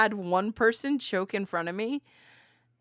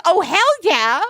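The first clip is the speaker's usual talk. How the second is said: higher and louder, gleeful emphatic yes; enthusiastic coworker; 9 semitones higher, 11 dB louder than usual. Retold or imitated. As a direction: channel a coworker proud of her vote.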